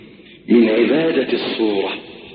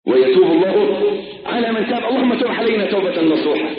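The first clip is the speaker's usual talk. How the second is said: usual speaking voice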